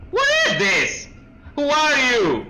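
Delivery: sudden high yelp of shock; startled shout. Real, not acted. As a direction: jump out of your skin and shout. Startled by a jump scare.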